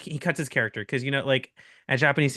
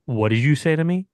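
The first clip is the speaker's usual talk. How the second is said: mock confrontation, a bit louder; straight up, accentless; 5 dB louder than usual. Chef dropping his act at the customer, straight-up challenge. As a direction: drop the act and challenge them squarely. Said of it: "like, no accent".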